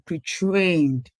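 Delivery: pre-trained drawn out slowly for emphasis; stress on pre-trained. Real, not acted. Teacher flags a key term for learners, pre-trained stressed and stretched. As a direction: lean on the word pre-trained, taking it slowly. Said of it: "You saw me stress that word pre-trained".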